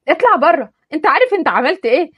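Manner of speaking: put-on furious girl throwing him out; angry outburst. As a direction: lash out at him in a rage. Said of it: "فانفعلت عليه بغضب".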